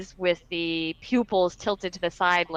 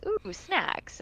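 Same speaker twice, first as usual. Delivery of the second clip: lightly voiced demon, higher and softer; a hint of a hungry creature, pitched up and soft; Abyssal demon muttering; 7 semitones higher, 5 dB quieter than usual. Game master repeats the demon's line, light Abyssal mutter. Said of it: "mutters in its abyssal tone".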